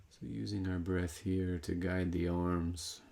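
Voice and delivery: calm, measured guidance with long pauses; guided meditation. Real, not acted. Meditation teacher guiding breath into the side body, calm guided meditation. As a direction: lead them unhurriedly through each movement, leaving room to breathe.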